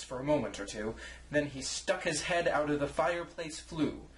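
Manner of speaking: usual speaking voice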